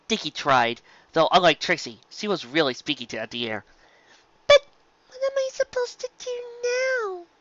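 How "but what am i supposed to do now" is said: put-on very high child voice; filly voice. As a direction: ask it lost like a small filly. Filly alone, dismayed.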